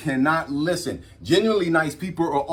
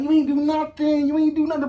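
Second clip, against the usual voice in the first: reassuring her; 12 semitones higher than usual. Retold or imitated. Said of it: "I'm like".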